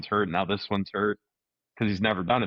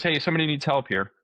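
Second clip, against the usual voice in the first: raised call for help across the mat; 8 semitones higher than usual. Retold or imitated. Retold official calling for help at the tournament. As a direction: call out for someone to come help.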